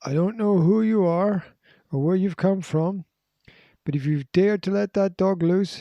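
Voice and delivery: a hidden stranger warning the intruder; threatening man. Acted. Narrator voices threatening man.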